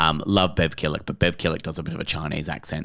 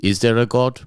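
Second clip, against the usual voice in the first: lightly voiced Polish priest; drunken priest's question in a Polish accent; 3 semitones higher, 4 dB louder than usual. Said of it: "do a bit of accent work".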